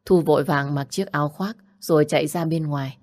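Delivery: ordinary delivery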